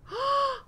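sharp, high gasp; shocked gasp. Real, not acted. Gamer seeing the dragon escape, shocked gasp. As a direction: gasp in sudden disbelief.

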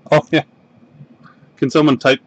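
usual speaking voice

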